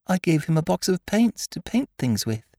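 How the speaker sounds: put-on high-pitched little boy's voice; child remembering. A narrator's reading. Christopher Robin remembering his box of paints, childlike.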